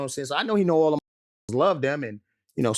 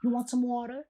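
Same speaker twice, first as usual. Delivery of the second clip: light high offer; 9 semitones higher, 5 dB quieter than usual. Retold or imitated. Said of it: "And I was like".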